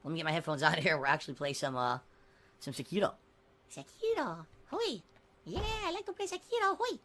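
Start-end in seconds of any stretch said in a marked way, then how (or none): 3.7-7.0 put-on high, soft little-kid voice; small child. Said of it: "trying to impersonate a small child".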